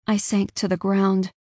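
plain reading voice

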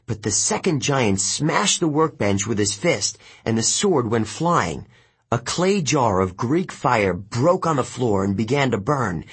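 ordinary delivery

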